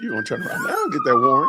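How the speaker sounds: high, fast mocking squeak; mocking squeaky voice. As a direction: squeak it out to mock them. Streamer mocks the officer.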